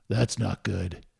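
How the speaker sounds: a hint of the raspy-voiced young fan; raspy nephew. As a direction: nephew deadpans it, low and a touch raspy. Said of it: "kind of raspy voice".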